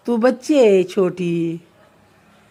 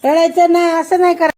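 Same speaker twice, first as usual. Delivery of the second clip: raised, higher scolding voice; firm scolding; 6 semitones higher, 5 dB louder than usual. Real, not acted. Parent hushing a crying child.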